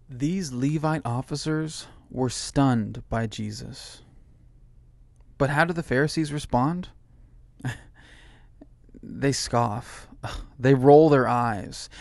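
ordinary delivery

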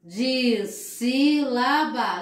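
dissílaba stretched out slowly; slow, careful dissílaba; slow teaching model. Shown as a demonstration. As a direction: draw dissílaba out for the class. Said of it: "Tem duas sílabas".

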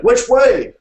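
high, loud shouted question; shouting. A narrator's reading. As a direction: yell for directions over galloping horses. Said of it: "he shouted".

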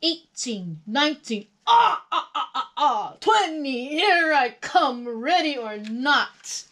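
loud, high character voice with staccato "ah, ah, ah" laugh; counting out loud. A narrator's reading. Hide-and-seek seeker calls to hidden players, counting out loud. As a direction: count grandly, drawing out the last words. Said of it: "somebody was counting out loud".

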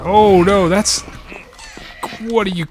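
high-pitched, loud burst of alarm, breaking into confusion; startled alarm. Real, not acted. Player surprised by another attacker, startled alarm. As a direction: cry out in startled disbelief.

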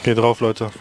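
ordinary talk

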